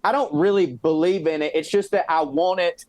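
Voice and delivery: usual speaking voice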